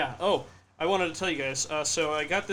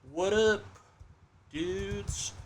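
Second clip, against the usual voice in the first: higher, stretched-out words with a gap between; drawn-out typing voice; 5 semitones higher, much slower than usual. Real, not acted. Host typing a greeting to chat viewers, drawn out. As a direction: sound it out slowly as you type.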